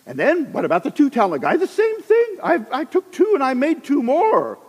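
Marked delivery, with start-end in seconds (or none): 1.6-4.6 pitched much higher, eager servant. Acted. Two talent servant reporting to master, higher and eager. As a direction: chirp it proudly like a keen servant.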